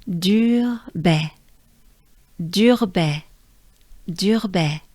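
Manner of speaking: "Dur baie" with breathed French R; French pronunciation demo. Shown as a demonstration. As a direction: repeat "dur baie" for learners, joining the words. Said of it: "let's do it together".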